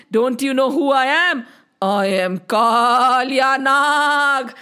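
slow, booming monster with stretched endings; menacing serpent. Acted. Giant serpent threatens the little boy, menacing. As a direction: draw out each threat like a villain.

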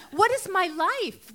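high, loud, slow exasperated plea; despairing cry. Retold or imitated. New mother stuck at home, despairing cry. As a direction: stretch it out in disbelief at her own life.

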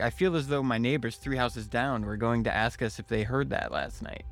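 ordinary delivery

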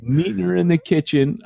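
high, teasing sly lilt; teasing innuendo. Pretend. Host teasing his co-host with a sly innuendo. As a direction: let the innuendo show in a lifted voice. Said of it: "I know, I'm teasing".

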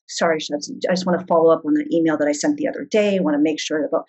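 low, quick, monotone office-speak; imitating a monotone email follow-up; emotionless corporate monotone. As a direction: rattle it off with no emotion like a corporate worker. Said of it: "you talk in this weird monotone way with no emotion".

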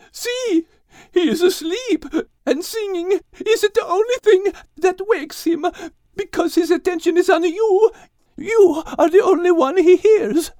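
high, tearful-sounding mare voice; pleading mare. A narrator's reading. The mare pleading that only Max's singing wakes him. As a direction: explain it, close to tears.

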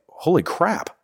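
blurted in awed disbelief; awed surprise. Retold or imitated. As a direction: caught off guard by the weight of it. Realising the day's significance.

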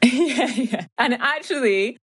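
amused laughing agreement. Real, not acted. Laughing agreement after joke.

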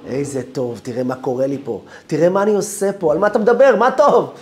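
starts at speaking level, climbs to a loud, high protest; exasperated retort, building. Retold or imitated. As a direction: let frustration swell into a near shout. Distressed retort to comforting friend. (תסכול שהולך ומתגבר לצעקה)

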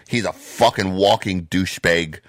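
ordinary talk, nothing marked

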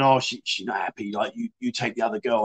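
low quiet mock confiding; mama san explaining. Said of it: "like, saying".

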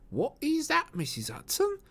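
falsetto landlady mimicking a question; Missus Hudson quoting. Landlady repeats lodger's question, high voice. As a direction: stay very high, dropping at the name.

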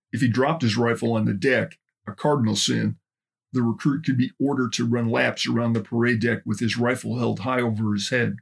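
ordinary delivery

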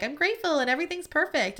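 high, quick, forced-bright cheer; fake cheer. Pretending to be grateful, she says it in fake cheer. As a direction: chirp it like everything is fine. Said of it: "I wanted to be like".

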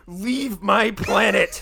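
high, slow, weird alien voice; slow alien command. Pretend. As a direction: draw it out like an alien. Alien queen repeats slowly.

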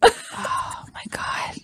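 slow, whispery high gasp; overwhelmed disbelief. Real, not acted. Host as the bottle finally opens, whispered stunned relief. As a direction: breathe it out slowly, stunned.

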